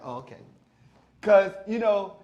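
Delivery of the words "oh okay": low, soft mock acknowledgement; subdued acknowledgement. Listener reacting to someone from Trenton, quietly subdued. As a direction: drop right down, quietly backing off.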